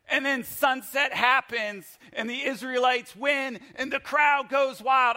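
high, loud sportscaster build; hyped crowd-goes-wild telling. Acted. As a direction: call it like a game-winning moment. Hyped retelling of the victory.

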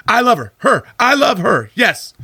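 high, frantic blurted confession; panicky Chandler. Retold or imitated. Chandler panics and confesses his love for Monica, high and frantic. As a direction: gush it out in a high rush. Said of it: "He's like".

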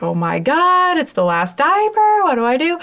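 high, panicky retold self; mock panic over the last diaper; panicked. Mom panicking about the last diaper, high and frantic. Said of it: "and I was like".

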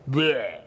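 low, dejected "bleh"; disgusted bleh. Acted. Preacher shows congregation how failing feels, low dejected bleh. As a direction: let out a feeling-lousy bleh. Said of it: "guess how we feel".

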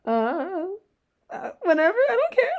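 high, giggly, careless reply; laughing brush-off. Retold or imitated. Replying to podcast requests.

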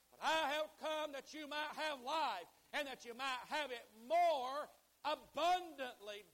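loud, high, ringing preacher cadence; full-force preaching. Real, not acted. Preacher warns congregation what anxiety steals, full-force preaching. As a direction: thunder it out with long, stretched key words.

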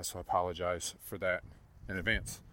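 usual speaking voice

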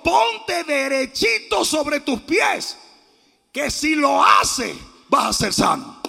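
slow, loud, forceful order; shouted command. Acted. Preacher acts Paul commanding the lame man, loud slow shout. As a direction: proclaim it at great voice, stretching words. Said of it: "Pablo le gritó a gran voz".

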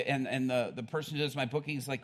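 usual speaking voice

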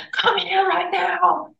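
high, slow mock command; insistent retold order. Wife ordering husband into the bedroom, insistent retold command.

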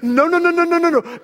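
high, rapid-fire mock alarm; panicked mother. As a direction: cut the child off in comic panic. Mother alarmed at the worse word.

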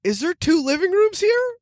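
put-on high, wide-eyed kid voice asking mom; a young boy amazed at the new house. Boy asks his mom about the huge house, high and amazed. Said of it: "I asked my mom, like".

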